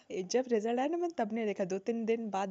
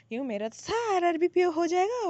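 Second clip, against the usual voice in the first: mock overconfidence; 7 semitones higher, 4 dB louder than usual. Voices her past overconfidence.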